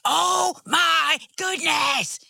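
drawn-out high-pitched exasperated shout; frustrated yell. Retold or imitated. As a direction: yell it slowly, at the end of your rope. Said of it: "I just yell".

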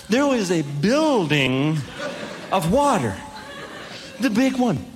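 drawn out with awe; slow it down for the reveal; dramatic pause before the punchline. Acted. Wave rising behind him.

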